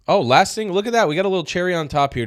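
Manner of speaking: high, loud gleeful oh; excited reveal. Real, not acted. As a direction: announce the treat with delight. Gleeful reveal of final clip.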